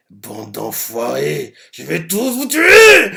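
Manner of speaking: hammed-up villain rage, drawn out; furious threat. The possessed face threatening everyone, slow hammed-up angry shout. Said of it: "Il est tellement énervé".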